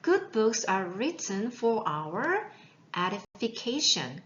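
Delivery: very slow, careful English; slow model reading. Shown as a demonstration. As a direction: read it slowly so students can repeat. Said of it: "teacher model reading".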